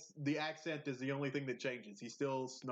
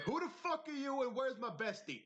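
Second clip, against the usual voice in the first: high-pitched mock indignation; mock outraged surprise; 10 semitones higher than usual. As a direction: demand it in mock shock, voice pitched way up. Acting Goku not recognising Krillin.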